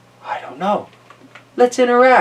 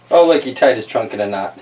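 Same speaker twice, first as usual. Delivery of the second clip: ordinary delivery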